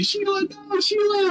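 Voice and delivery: high-pitched cry, held back in volume; acted anguished yell. Angela yells at Sheila's body, mock anguished yell. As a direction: wail it high, like a stage yell. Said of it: "she's gonna yell at Sheila's body".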